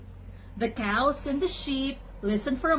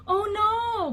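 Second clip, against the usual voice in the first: high, wailing sheep cry; drawn-out high bleat; bleated dismay; 11 semitones higher than usual. A narrator's reading. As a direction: wail it out like a worried sheep. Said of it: "bleated the sheep".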